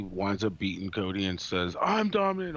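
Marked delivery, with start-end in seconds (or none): 1.8-2.6 boastful shout. Retold or imitated. Quoting Roman boasting after win.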